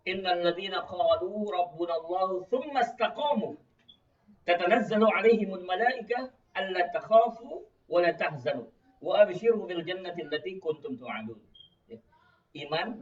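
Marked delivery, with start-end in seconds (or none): 0.1-11.4 raised, carrying Arabic recitation; Quran recitation. Real, not acted. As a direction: recite the verse out strongly. (tilawah Al-Qur'an dengan suara lantang)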